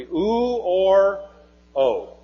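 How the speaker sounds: "u" as a long OO, then "o" as OH; vowel sound demo. Shown as a demonstration. Teacher showing the vowels of vav, sounded out. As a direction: hold "u" high as oo, then drop to "o". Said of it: "either a consonant or a vowel".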